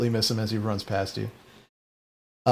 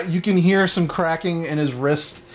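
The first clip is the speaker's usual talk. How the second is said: ordinary talk, nothing marked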